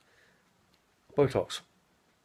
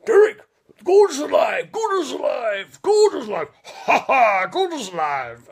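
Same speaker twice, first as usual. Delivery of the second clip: huge booming shout, much higher and louder; Brian Blessed impression; 13 semitones higher, 10 dB louder, slower than usual. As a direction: roar it like a larger-than-life actor. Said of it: "and I can't do it".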